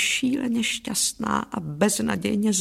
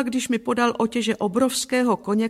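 ordinary delivery, nothing marked

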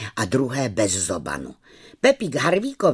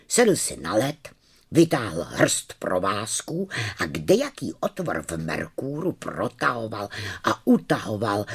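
usual speaking voice

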